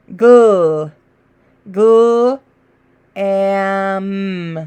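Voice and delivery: "gl" said twice slowly, then "am"; separate sounds. Shown as a demonstration. Teacher sounding out the word slowly. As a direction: sound out gl, gl, am apart. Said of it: "those are the sounds".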